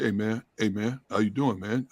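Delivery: low imitation of a deep-voiced man. Intimidating linebacker greeting people off the field, imitated deep voice. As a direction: drop down to mimic his real deep voice. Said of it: "he had this real deep voice".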